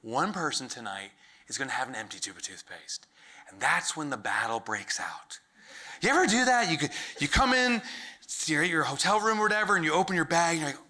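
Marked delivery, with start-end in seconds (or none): 1.5-5.4 hushed whisper. Acted. Whispering to the crowd about the coming battle. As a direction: whisper the line like a movie trailer.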